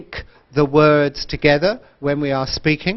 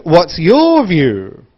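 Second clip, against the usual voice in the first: slow, loud swell on "your", falling on "view"; wide intonation; 2 semitones higher, 6 dB louder, much slower than usual. Shown as a demonstration. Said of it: "think about connected speech and intonation".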